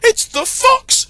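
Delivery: very high, loud alarmed shout; high panicked yell. Acted. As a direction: raise the alarm on the farm. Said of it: "she shouted".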